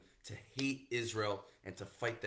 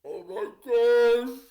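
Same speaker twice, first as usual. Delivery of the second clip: drawn-out high, very loud wail; theatrical cry of despair; mock crying; 13 semitones higher, 17 dB louder, much slower than usual. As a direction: wail it out, hammed up. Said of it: "He starts crying".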